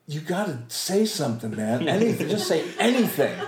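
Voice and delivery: urgent self-prodding. Retold or imitated. Frozen actor urging himself to speak, mock-desperate and high. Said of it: "And I think".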